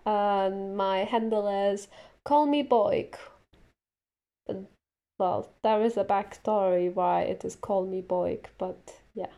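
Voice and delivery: usual speaking voice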